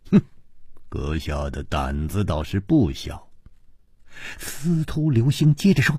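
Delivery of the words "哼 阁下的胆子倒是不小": cold backhanded praise, voice kept low. A narrator's reading. Ji Bingyan remarks on the man's daring, coldly. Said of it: "冷冷地说".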